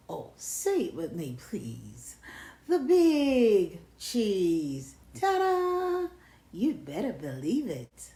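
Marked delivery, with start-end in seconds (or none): 2.7-5.0 grand drawn-out announcement. Acted. 5.2-6.1 ta-da fanfare. Acted. Character's fanfare.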